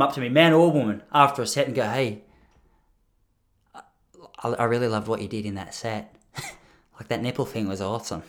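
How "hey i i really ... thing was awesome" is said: hushed, softer creepy fan; creepy admirer. Retold or imitated. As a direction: lean in and murmur like an odd fan. Creepy fan after the set.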